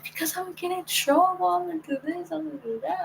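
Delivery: high, soft mimicked excuse-making; girlfriend's excuses. Girlfriend explaining to her why she never sends voice messages, mimicked high and soft.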